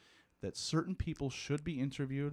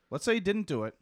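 louder and higher, insisting; emphatic restatement; 8 semitones higher, 6 dB louder than usual. Real, not acted. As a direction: repeat it with force to correct yourself.

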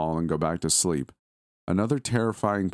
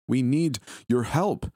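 higher, slow, insistent plea in a woman's voice; desperate, demanding woman; 8 semitones higher, slower than usual. Acted. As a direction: demand help, stretching each word. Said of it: "more desperate, more demanding".

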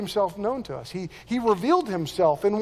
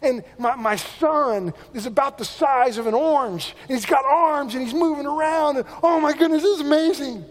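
retold excitement building higher and louder; thrilled father-to-be; 7 semitones higher, 3 dB louder than usual. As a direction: gush about the baby, climbing in pitch. Voices atheist friend thrilled about son.

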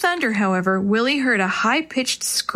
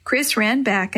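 usual speaking voice

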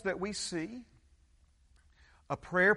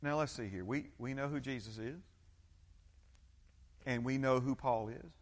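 lightly voiced demon, low and soft; a hint of a sly demon; 8 semitones lower, 9 dB quieter than usual. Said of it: "the demon says".

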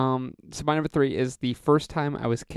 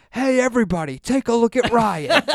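mocking teacher; 10 semitones higher, 4 dB louder than usual. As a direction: hold him up for the class to laugh at. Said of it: "And she goes".

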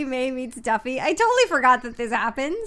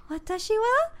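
high cutesy character question; little high-voiced mimicry; Shelly May; 10 semitones higher than usual. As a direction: pitch it way up like a cartoon. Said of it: "And then Shelly May goes".

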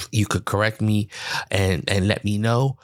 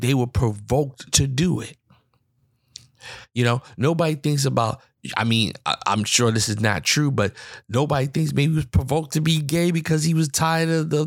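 heated indignation; 6 semitones higher than usual. Real, not acted. Indignant rant about accountability.

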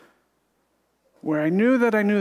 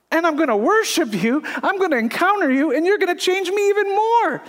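rising preaching fervor; 10 semitones higher than usual. Real, not acted. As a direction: lift the voice into full preaching cry. Preaching climax.

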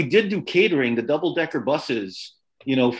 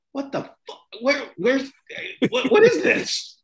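stunned disbelief; 10 semitones higher than usual. Retold or imitated. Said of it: "Gil and I and Scott are like".

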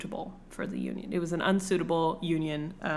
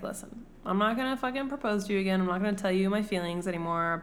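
rushed Darcy explanation; much faster than usual. Retold or imitated. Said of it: "And he's like".

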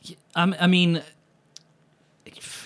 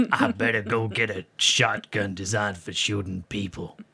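lower, softer hammed-up American accent; 5 semitones lower, 4 dB quieter than usual. Shown as a demonstration. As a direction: play a gun-loving American in a fake accent. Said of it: "do an American accent".

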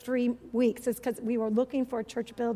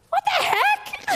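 very high, loud disbelief; shocked exclamation; 5 dB louder, slower than usual. Retold or imitated. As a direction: blurt it out in shock. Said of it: "I was like".

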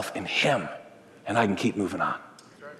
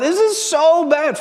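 put-on high, slow, drawn-out child's whine; whiny little girl; 13 semitones higher, 4 dB louder, slower than usual. Pastor imitating his daughter whining from the other room, high and slow. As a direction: whine it out like a four-year-old over nothing. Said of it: "she just whines".

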